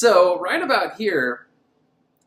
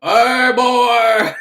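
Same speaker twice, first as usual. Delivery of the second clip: loud, high put-on woman's call; mock female villain; 7 semitones higher, 10 dB louder than usual. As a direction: turn the villain's tagline into a high-pitched shout. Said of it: "What if he were the chick".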